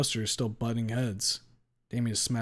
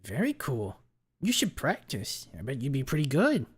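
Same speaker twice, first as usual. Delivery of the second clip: high, excitable nerd voice; higher voice, swooping up with approval; impressed gamer nerd; 4 semitones higher than usual. Acted. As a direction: pitch it up, pleasantly surprised by a newcomer. Buster encouraging the newcomer.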